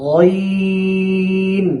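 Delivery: long sung Ghain, held steady; Arabic letter demo. Shown as a demonstration. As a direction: chant গইন from the back of the throat. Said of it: "গাইন এমনও যেন না হয়". (গলার শেষ থেকে টেনে গইন)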